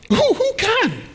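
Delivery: very high comic squeaky question; high-pitched exasperated falsetto; incredulous squeal. Acted. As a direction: shoot the pitch right up in mock disbelief. Comic incredulous question after long pause.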